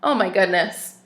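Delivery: ordinary delivery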